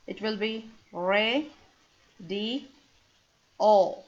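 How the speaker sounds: radio split into re, di, o; radio in syllables. Shown as a demonstration. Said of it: "If we divide radio into parts".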